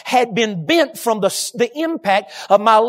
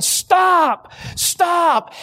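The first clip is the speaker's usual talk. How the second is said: retold scream; 6 semitones higher than usual. Man flagging down traffic to warn drivers, mock screaming. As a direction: cry a warning, pitched well up. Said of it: "screaming and waving wildly".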